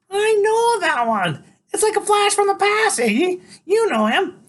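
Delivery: very high, loud woman's squawk; insistent Loretta. A narrator's reading. Loretta tells Iggy she knows the boy, high squawk.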